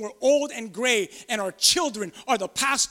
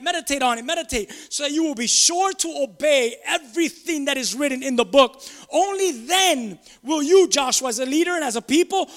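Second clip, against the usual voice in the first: loud, rising sermon delivery; preaching at full force; 4 dB louder than usual. Acted. Pastor preaching God's promises to Joshua, full force. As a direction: preach it to the congregation.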